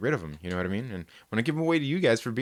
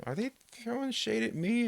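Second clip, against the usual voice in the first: squeaky character voice; 13 semitones higher, 3 dB quieter than usual. Retold or imitated. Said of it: "kinda got on him".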